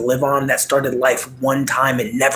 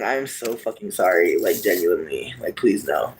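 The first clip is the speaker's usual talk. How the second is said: sincere apology; 6 semitones lower, 3 dB quieter than usual. Real, not acted.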